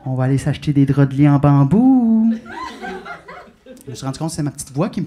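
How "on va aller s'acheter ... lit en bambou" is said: low coaxing voice swelling at the end; little inner voice. Acted. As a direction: draw out a loud, rising BAMBOU. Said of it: "elle revient à la charge un peu plus fort".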